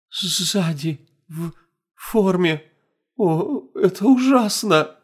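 high, slow horrified half-whisper. Acted. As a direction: shaken voice near tears. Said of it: "прошептала Лили".

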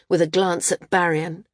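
ordinary delivery, nothing marked

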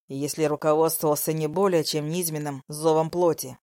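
ordinary talk, nothing marked

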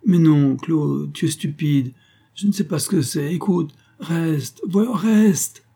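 high-pitched flustered pleading, rising as it goes; acted panicky protest, slowing into a drawn-out plea; ashamed, stammering. A narrator's reading. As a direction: beg her to stay, caught out. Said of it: "honteux et balbutiant".

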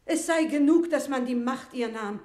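laut und hoch empört; empörter Ausruf. Acted. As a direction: aufgebracht protestieren. Amme verteidigt die Königin empört. (Amme protestiert laut gegen Paulet, empört)

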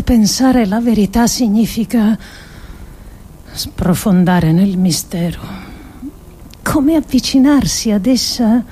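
ordinary talk

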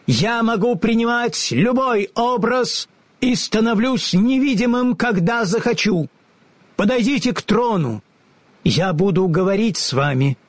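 high, lofty and commanding; grand wizard voice. A narrator's reading. The unseen wizard commands the travellers, high and lofty. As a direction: speak as an all-powerful unseen ruler.

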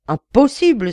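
loud, high, flat-out no; shouted refusal. A narrator's reading. Governor refuses the prisoner's plea with a loud cry. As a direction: governor cuts him off with a cry. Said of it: "s'écria le gouverneur".

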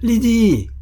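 appel très aigu et fort, qui traîne; cri lointain. A narrator's reading. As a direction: crie le prénom pour qu'on l'entende de loin. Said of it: "cria encore la voix d'Eugène". (le garçon appelle encore sa sœur, en criant)

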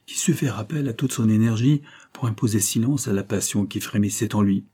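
ordinary delivery, nothing marked

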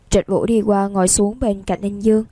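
plain reading voice

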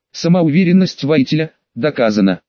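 ordinary delivery

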